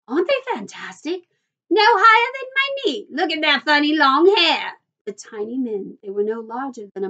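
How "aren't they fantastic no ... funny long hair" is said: high, loud cries of amazement; excited shouting. A narrator's reading. Onlookers stare at the tiny men, shouting excitedly. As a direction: exclaim like an astonished onlooker. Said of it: "everybody started shouting at once".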